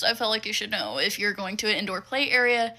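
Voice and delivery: ordinary talk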